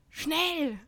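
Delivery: high urgent yell. Acted. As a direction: shout it in a hurry. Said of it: "Brian schrie".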